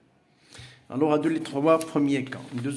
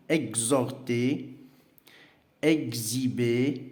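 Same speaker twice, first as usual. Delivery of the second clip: slow model words; much slower than usual. Shown as a demonstration. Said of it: "E X plus un H muet".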